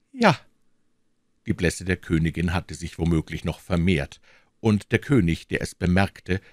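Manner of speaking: ordinary delivery, nothing marked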